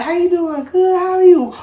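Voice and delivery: high, sugary mimicry of small talk; mock polite small talk. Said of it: "people be like".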